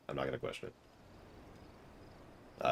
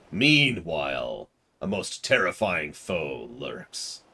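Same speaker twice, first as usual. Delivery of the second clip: slow ominous villain voice; sinister villain. Acted. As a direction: creep the words out like a lurking foe.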